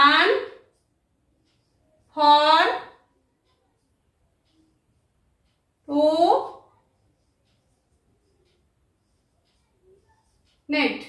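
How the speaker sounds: আন, ফর, টু, নেট with long pauses; each syllable of আন, ফর, টু, নেট standing alone; split into syllables. Shown as a demonstration. Teacher showing four parts of a word, syllable by syllable. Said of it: "চারটি অংশ আছে".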